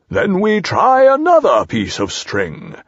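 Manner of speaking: high, slow, swooping owl; stretched storybook owl voice; Owl explaining. Acted. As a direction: draw it out like an untroubled owl.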